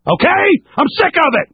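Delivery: high-pitched, exasperated burst; fed-up outburst. Real, not acted. Host fed up with the system, exasperated outburst. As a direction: let the exasperation jump out, pitch way up.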